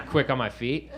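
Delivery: usual speaking voice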